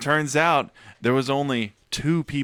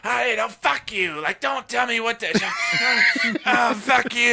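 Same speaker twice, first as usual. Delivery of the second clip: mock furious manager; 10 semitones higher than usual. Imitating the manager shouting back when told to fix it, high mock rage. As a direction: yell it back, high and outraged, with a laugh.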